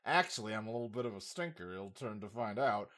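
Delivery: low, soft, quick confidential aside; villain's aside. Acted. Nice businessman admitting he's a stinker, low aside. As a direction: drop low and soft for the secret.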